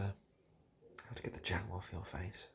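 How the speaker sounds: usual speaking voice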